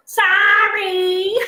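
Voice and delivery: put-on high, loud, long-drawn child's reply; mock child's sorry. Child answering Siri's scolding, sing-out mock apology. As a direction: stretch it out high like a cheeky kid.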